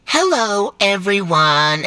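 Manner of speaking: ordinary talk, nothing marked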